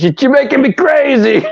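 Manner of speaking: high, whiny quote of Punk; mock whine. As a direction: whine it like a wrestler's crying promo. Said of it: "Punk goes".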